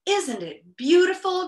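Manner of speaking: high, loud, amazed question; gasped wonder. A narrator's reading. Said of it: "gasped Milo".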